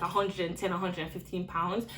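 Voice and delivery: usual speaking voice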